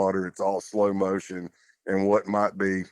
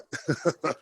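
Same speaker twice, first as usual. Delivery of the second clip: short laugh; 7 semitones higher, 3 dB quieter than usual. Retold or imitated. Nervous joke during search.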